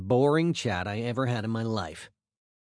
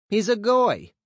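disapproving parents; 10 semitones higher, 6 dB louder than usual. Retold or imitated. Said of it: "my parents said".

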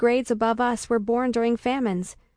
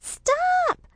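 put-on extremely high child squeal; piercingly high, protesting kid voice; little sister crying out. A narrator's reading. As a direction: squeal in protest like a small girl. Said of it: "she cries".

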